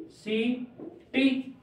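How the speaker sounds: सी and टी said apart with a clear pause; syllable by syllable. Shown as a demonstration. Breaks dictated word into syllables.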